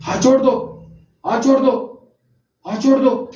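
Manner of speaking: put-on high, firm instruction to a child; commanding high-pitched order. Shown as a demonstration. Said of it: "high pitch के साथ बात कर रहा होता हूँ और फर्म कमांड".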